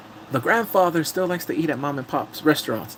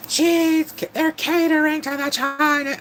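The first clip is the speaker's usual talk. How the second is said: high, slow squawking complainer; hammed-up indignant screech, dragged out; mock outrage; 14 semitones higher, slower than usual. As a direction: parody a scandalized critic, voice pitched way up. Imagines an outraged online critic.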